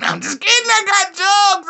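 very high, loud squeal; squealed just-kidding. Pretend. Comic takes back crude remark, high loud squeal.

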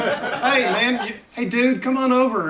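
lightly put-on voice, high and hearty; party host calling over. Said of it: "to parties to talk Southern".

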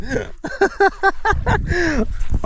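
high shivery laugh. Real, not acted. Laugh in cold water.

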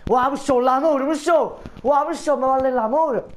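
excited. Acted. Excited mock reaction to love.

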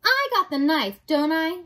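defiant. Acted. Mig takes up the knife against rat, loud defiance. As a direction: announce it boldly.

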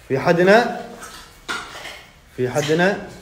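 scared loud call into the dark. Pretend. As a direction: yell to see if anyone is there. Calling out in haunted house.

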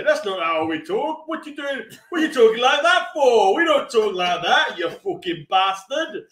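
indignant mock Yorkshire native; hammed-up, scolding Yorkshire voice; Yorkshire accent. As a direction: complain in a put-on Yorkshire accent. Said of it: "Apart from people from Yorkshire".

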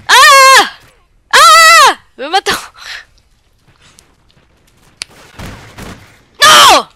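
high loud yells of defeat; real gamer yells; pained shouts. Real, not acted. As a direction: scream as you get killed. Getting killed in game.

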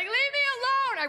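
high, slow shriek of a yell; hammed-up high scream; mock yell. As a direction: scream it for the neighbors. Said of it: "I'd start yelling".

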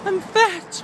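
very high, mock whiny wail; mock whiny girl. Mocking girls who call themselves fat, high whiny wail. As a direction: ham up a girl's fake despair. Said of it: "I'm not like".

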